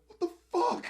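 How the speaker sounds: squeaky, very high exclamation; mock stunned disbelief. Junkie left in the police car, high baffled yelp. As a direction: yelp it in comic bewilderment. Junkie watches cop enter house.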